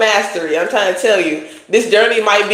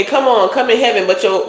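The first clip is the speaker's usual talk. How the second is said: ordinary talk, nothing marked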